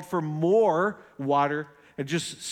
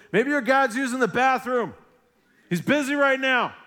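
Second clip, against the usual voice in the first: mocking jibe, much higher and louder; joking taunt; 9 semitones higher, 5 dB louder than usual. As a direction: tease them with a laughing jab. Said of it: "he's laughing, he's joking".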